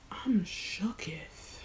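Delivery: very slow stunned whisper; drawn-out hushed shock. Real, not acted. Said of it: "If, if I'm whispering".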